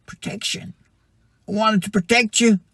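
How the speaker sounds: high, pleading voice of God; a high, aching plea of God. Acted. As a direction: plead as a heartbroken parent. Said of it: "voicing God's longing".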